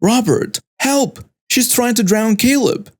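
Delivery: understated panicked call to husband; mild acted scream. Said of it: "Then I screamed".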